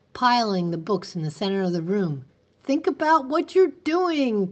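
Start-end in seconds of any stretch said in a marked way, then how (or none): 2.6-4.5 pleading cry, pitched high. A narrator's reading. Teacher pleading with the mob, crying out high. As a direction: cry out to stop them. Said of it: "cried Miss Catherine".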